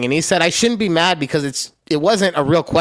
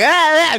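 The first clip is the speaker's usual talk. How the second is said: high mock-frantic "ah, ah"; short high yelps, playfully overexcited; animated yelps; 11 semitones higher, 4 dB louder than usual. Shown as a demonstration. Host mimicking his usual self, animated yelps. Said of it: "Usually I'm all animated".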